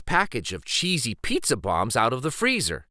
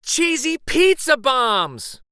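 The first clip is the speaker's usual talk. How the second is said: high, loud, slow excited yell; 13 semitones higher, 6 dB louder, slower than usual. Acted. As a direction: stretch it out like a kid overjoyed about a treat. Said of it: "Luis yelled".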